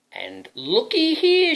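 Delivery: high, slow, loud witch crowing; hammed-up witch; excited witch cry. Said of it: "she cried".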